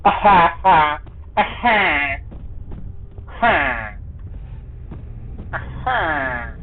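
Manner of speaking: high nasal-style grunting "aha", falling off; Minecraft villager. Acted. Player goofing over cogwheels, villager impression. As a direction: hum out short creature calls, fading. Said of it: "I'm a Minecraft villager".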